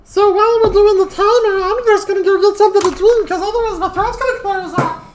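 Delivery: high squeaky loud gremlin voice. Acted. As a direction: talk like a cheeky little gremlin. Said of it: "I'm currently in gremlin voice".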